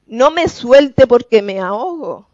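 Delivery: cling and beg; mock plea.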